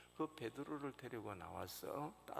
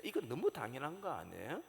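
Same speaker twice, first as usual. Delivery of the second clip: usual speaking voice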